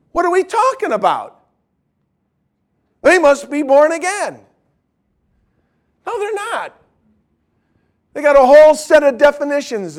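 worked-up, high-pitched exasperation; impassioned rebuttal; emotional preaching. Real, not acted. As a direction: challenge them with the pitch pushed high. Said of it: "getting a little emotional".

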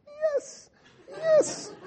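very high squeaky glee; gleeful yes. Retold or imitated. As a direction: squeal with delight. Cheering quietly at success.

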